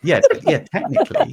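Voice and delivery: squeaky teasing voice. Pretend. Teasing answer about knife.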